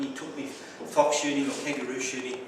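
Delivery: usual speaking voice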